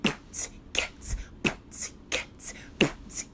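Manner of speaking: unvoiced "Boots, cats", consonants only; whispered beatbox. Shown as a demonstration. Teacher showing how the class beatboxes together. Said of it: "we'll get the whole class going".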